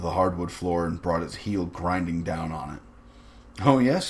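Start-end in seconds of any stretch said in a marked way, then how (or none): 3.6-4.1 airy, higher and louder aside; breezy offhand acknowledgement; casual. A narrator's reading. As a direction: toss it off casually. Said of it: "he said casually".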